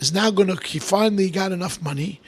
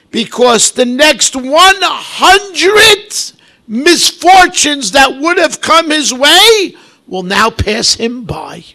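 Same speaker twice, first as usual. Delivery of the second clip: high, loud, slow sermon voice; a loud drawn-out pulpit exhortation; fervent preaching; 6 semitones higher, 9 dB louder, slower than usual. Acted. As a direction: preach it slowly with fire. Preaching at full force.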